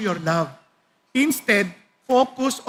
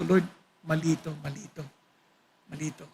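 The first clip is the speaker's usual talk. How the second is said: much softer and lower, fading out; hushed prayer; 9 semitones lower, 10 dB quieter, faster than usual. Retold or imitated. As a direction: murmur it like a private confession. Retells a remorseful prayer. (mahinang dasal na nagsisisi)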